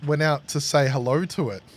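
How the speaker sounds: ordinary talk, nothing marked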